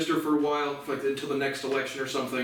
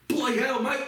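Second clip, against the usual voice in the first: mock Australian; 9 semitones higher than usual. Mock Aussie slip.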